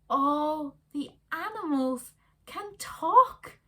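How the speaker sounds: put-on amazed kids. A narrator's reading. Kids realising.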